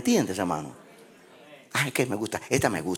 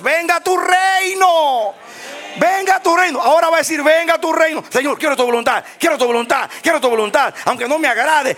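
fiery preaching; 9 semitones higher, 5 dB louder than usual. Acted. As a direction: cry out the prayer at full force. Preaches a shouted prayer.

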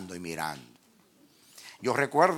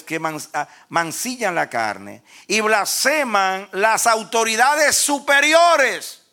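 slow, high, loud pulpit declamation; full-force preaching; 10 semitones higher, 9 dB louder, much slower than usual. Real, not acted. Preacher expounding Judas on rebels to the congregation, full-force declamation. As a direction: stretch and thunder each phrase, building.

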